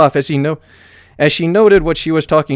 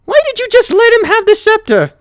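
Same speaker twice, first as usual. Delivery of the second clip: very high, louder accusing question; exasperated pony; 4 dB louder than usual. A narrator's reading. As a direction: demand an answer. Twilight voice.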